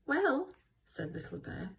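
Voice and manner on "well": tiny high-pitched storybook voice. Shown as a demonstration. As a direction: pipe up in a small, high character voice. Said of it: "Little Bear, little voice".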